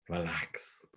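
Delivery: hushed, unvoiced whisper to himself; whispered self-calming. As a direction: whisper it low, settling your own nerves. Calming himself before speaking.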